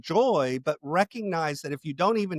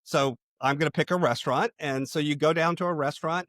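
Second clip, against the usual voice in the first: ordinary delivery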